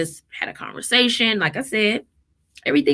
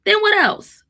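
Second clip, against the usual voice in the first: "Then what else?" asked much higher, pressing; emphatic question; 11 semitones higher than usual. Real, not acted. Mom challenging her listeners, pitched high.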